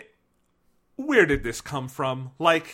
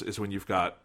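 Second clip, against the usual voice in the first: ordinary talk, nothing marked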